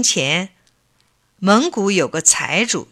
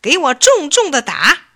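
loud, higher order to beat him; stern command; 8 semitones higher, 6 dB louder than usual. Acted. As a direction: bark the order to the guards. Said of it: "命令手下人说".

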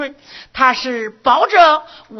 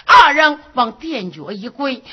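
ordinary delivery, nothing marked

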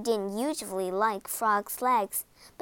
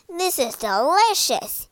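loud, higher cry of delight; 6 semitones higher, 7 dB louder than usual. A narrator's reading. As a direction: exclaim over the food with gusto. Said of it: "he cried between mouthfuls".

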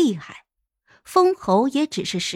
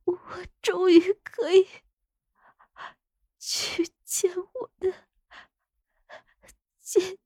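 high, weak, gasping sobs; tearful dying voice; 10 semitones higher, 4 dB quieter than usual. Acted. Dying consort avenged for the sister, high breathless tearful voice. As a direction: break the words up with failing breath.

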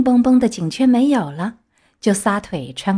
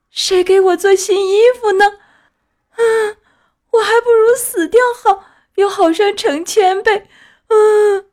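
put-on high, loud wailing plea of a lost boy; a puppet's high, loud grief-stricken wail; crying lament; 10 semitones higher, 5 dB louder than usual. A narrator's reading. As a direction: sob it out high and loud, begging the dead fairy. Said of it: "他哭着说".

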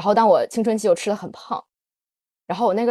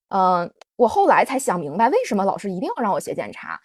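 ordinary talk, nothing marked

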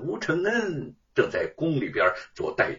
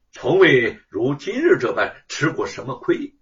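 plain reading voice

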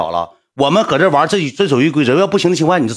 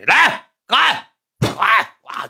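put-on drunk shouting; 8 semitones higher, much slower than usual. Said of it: "就喊上了".

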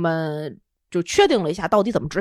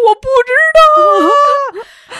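much higher, louder, slow mock wail; 11 dB louder, much slower than usual. Tells husband she doesn't know why she cries, slow wail. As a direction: drag the words out like a sob. Retells crying answer to husband.

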